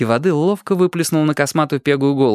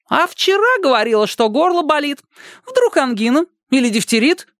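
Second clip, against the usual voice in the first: put-on much higher cheeky boy voice; teasing boy. A narrator's reading. Upside-down boy teases the neighbours, high and cheeky. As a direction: needle them like a little brat.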